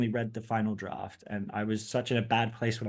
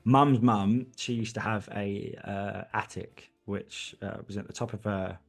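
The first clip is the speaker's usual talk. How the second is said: British accent; 2 semitones lower than usual. Shown as a demonstration. Interviewee recalls grandmother's attic tea on request, British accent. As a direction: put on your best British accent, understated and unhurried. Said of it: "answer the question with your best British accent".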